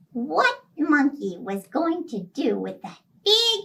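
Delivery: ordinary delivery, nothing marked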